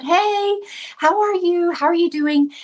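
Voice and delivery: high, sing-out hello; friendly greeting. Retold or imitated. Manager greeting customers at Panera, friendly and high-pitched. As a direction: greet them kindly with pitch lifted right up.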